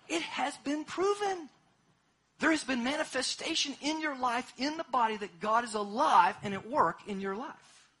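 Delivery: loud, rising sermon climax; full-force preaching. Acted. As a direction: preach it out, big and ringing.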